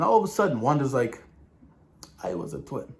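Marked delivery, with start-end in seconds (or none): 2.2-2.9 low, hushed imitation of a Sokovian accent; quiet, lightly imitated Sokovian accent. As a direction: softly mimic a Sokovian accent. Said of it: "She brings out that Sokovian accent".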